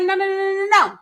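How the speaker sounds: high, loud string of no's; hurried denial. Retold or imitated. [name] retold protesting to Courtney, high frantic no's.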